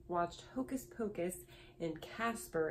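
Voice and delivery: ordinary talk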